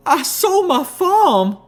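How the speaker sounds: very high, loud, slow anguished wail; tearful cry. A narrator's reading. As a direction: wail it through tears, stretched out. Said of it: "wide with tears".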